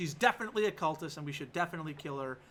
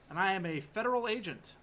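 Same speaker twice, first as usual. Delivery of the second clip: mock tough agent; 5 semitones higher, 5 dB louder than usual. Agent character speaking.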